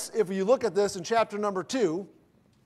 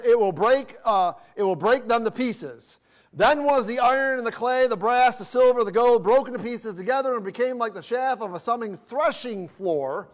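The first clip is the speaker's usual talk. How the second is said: scripture reading; 4 semitones higher than usual. Acted. Reads scripture verse.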